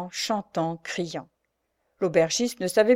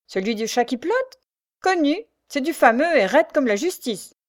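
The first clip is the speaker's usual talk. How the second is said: higher, lively character voice; enthusiastic coachman; 7 semitones higher, 4 dB louder than usual. A narrator's reading. Coachman to sergeant about famous cider, enthusiastic. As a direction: relish it, pitched up.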